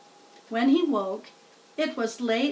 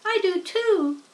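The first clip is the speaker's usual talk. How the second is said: name herself proudly; little spider voice; 8 semitones higher, 4 dB louder than usual. A narrator's reading. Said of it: "said Arania".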